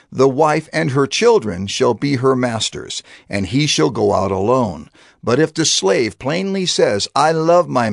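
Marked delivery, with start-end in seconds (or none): none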